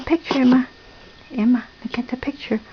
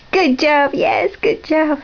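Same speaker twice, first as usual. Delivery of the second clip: high, stretched-out praise; excited praise; 5 semitones higher, slower than usual. Real, not acted. Praises toddler's answer.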